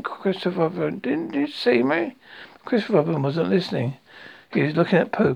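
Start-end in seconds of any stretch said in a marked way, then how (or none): 1.0-2.2 high, drawn-out little plea; Roo's pleading. A narrator's reading. Roo seeking Christopher Robin's attention, high slow plea. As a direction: stretch it out high, wanting to be noticed.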